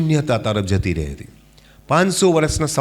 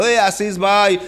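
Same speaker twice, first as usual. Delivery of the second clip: a friend calling his name, raised and lifting; fond call by name; 7 semitones higher, slower than usual. Retold or imitated. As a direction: call out to Ashish fondly over the noise. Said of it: "પ્રેમથી બોલાવતો".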